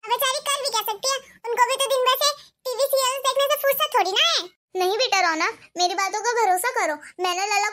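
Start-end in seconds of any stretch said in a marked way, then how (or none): 0.0-4.4 put-on higher, sharper voice of a scolding young wife, quick in places; a bossy bahu telling off her mother-in-law; shrill daughter-in-law. [name] mocks mother-in-law. (सास को डाँटती तेज़ बहू, ऊँची आवाज़ में)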